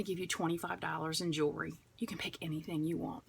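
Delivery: secret whisper of a perk. Real, not acted. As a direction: lean in and whisper the treat.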